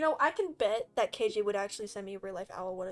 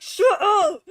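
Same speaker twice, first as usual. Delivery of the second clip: loud, higher mock-angry shout; retold snap; 8 semitones higher, 10 dB louder than usual. Streamer retelling how she'd answer teasing, mock angry. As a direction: throw it out as an exasperated yell.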